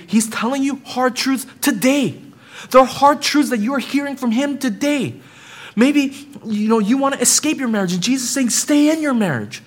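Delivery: high, loud, urgent sermon delivery; pleading with the congregation to take the hard truths; fervent preaching. Acted. Preacher urging Christians to stay and obey, fervent preaching.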